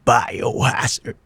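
slow, drawn-out dramatic title call; Japanese title announcer. Retold or imitated. Host mimics the Japanese version's title announcer. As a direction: stretch it like a game intro voice. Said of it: "ถ้าคุณเล่นเวอร์ชันญี่ปุ่นก็จะ".

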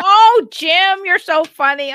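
loud, high, hammed-up schmoozing; imitated work-call voice. Wife mimicking her husband on a work call, loud and exaggerated. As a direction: boom it out in mock office-call flattery. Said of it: "when he's on the call".

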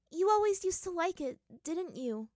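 put-on high-pitched girlish fondness; smiling daughter. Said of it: "allowing a smile to show itself".

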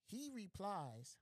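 slow dramatic setup. Acted. Storyteller slowly teasing the punchline to listeners. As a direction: stretch the words to hold suspense.

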